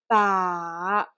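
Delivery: long "ba" falling first, then rising; V-shaped pitch on "ba"; third tone. Shown as a demonstration. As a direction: dip "ba" down and lift it quickly at the end. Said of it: "practice the third tone".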